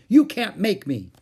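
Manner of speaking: high, loud taunting refusal; defiant yell. A narrator's reading. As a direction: yell back like a stubborn boy. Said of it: "yelled Howie".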